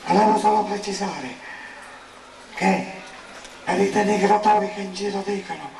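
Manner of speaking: weak, unwell voice. Real, not acted. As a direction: speak as if physically drained. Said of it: "Non sono nelle condizioni fisiche".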